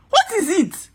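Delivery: high, incredulous demand; real indignation. Real, not acted. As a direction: throw the question out high, then drop sharply. Indignant rhetorical question.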